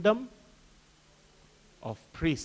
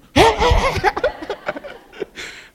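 a long high burst of laughter; real loud giggle; 7 dB louder than usual. Real, not acted. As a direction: crack up at your own joke. Preacher laughs at own joke.

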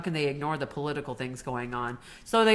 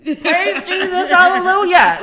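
mock jubilant praise; 16 dB louder than usual. Host acting a joyful shout about women in power. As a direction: belt it like a church celebration. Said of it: "it was just like this".

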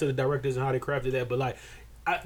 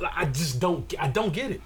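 ordinary talk

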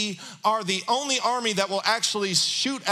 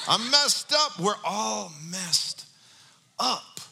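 ordinary talk, nothing marked

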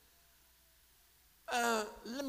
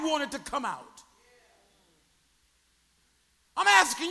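loud, higher, driving sermon questions; full-force preaching; 5 semitones higher, 7 dB louder than usual. Acted. Pastor presses the congregation, loud rising preaching. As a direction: shout the challenge at the congregation.

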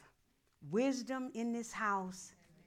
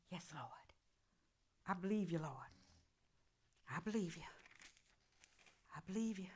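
low, softer murmur of faith; whispered prayer; 3 semitones lower, 7 dB quieter, faster than usual. Real, not acted. Pastor whispering faith to the Lord, soft and low. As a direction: breathe it as a private prayer. Private prayer between thoughts.

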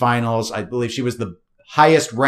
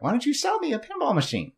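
high mock pleading voice; 13 semitones higher, 6 dB quieter than usual. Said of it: "other people are like".

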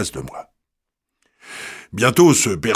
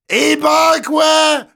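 put-on hoarse Paris street girl, much higher, shouting; hoarse working-class voice; 3 dB louder, much slower than usual. The girl turns on the young man, hoarse, shouting. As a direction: snap back like an angry faubourg girl. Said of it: "d'une voix enrouée et faubourienne".

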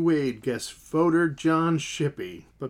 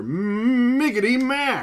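slow, high announcer build; announcer introduction; 9 semitones higher, 4 dB louder, slower than usual. Acted. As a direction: announce him like a star. Host introduces co-host grandly.

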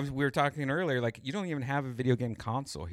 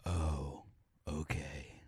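low, soft deep-voice reply; converted deep voice; 6 semitones lower, 7 dB quieter, much slower than usual. Acted. Co-host converted by caller, answers low and quiet. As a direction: drop low as if hypnotised. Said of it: "got Red Band to talk like that".

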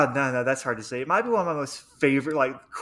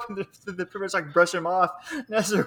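ordinary talk

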